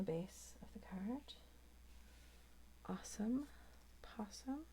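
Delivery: playful whispered rhyme; soft whispered awesome possum. Real, not acted. Whispered rhyme while working.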